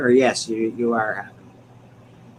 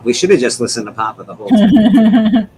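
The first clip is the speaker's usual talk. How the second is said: mock reverent; 10 semitones higher, 12 dB louder than usual. Mock praise of Papa.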